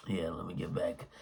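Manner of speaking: whispered aside. Real, not acted. Quiet aside to himself.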